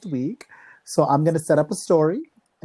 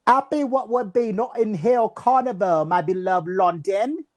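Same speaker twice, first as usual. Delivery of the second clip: higher, playful British-Jamaican caption reading; mock British-Jamaican accent; 5 semitones higher than usual. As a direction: read it in hammed-up Jamaican accent. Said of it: "in that wonderful accent".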